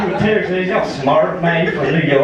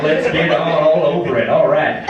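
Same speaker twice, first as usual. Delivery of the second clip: put-on Rusty, cocky Southern good old boy. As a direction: play a rowdy Southern comic working the crowd. Plays Rusty the Southern comic.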